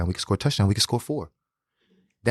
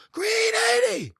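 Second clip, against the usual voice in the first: high, drawn-out slow-motion play call; slow-mo quarterback call; slower than usual. Retold or imitated. Quarterback calling the play to him, high slow-motion voice. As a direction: stretch it out like a slowed-down replay. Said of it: "slow-mo".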